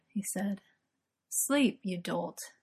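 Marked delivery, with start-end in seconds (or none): 1.3-2.5 grumbled. A narrator's reading. Merlin hushing his worrying boyfriend, grumbling. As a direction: grumble it and let it sink away. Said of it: "Merlin grumbled".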